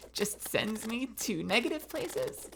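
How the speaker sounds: frustrated whisper. Real, not acted. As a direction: breathe it out under your breath.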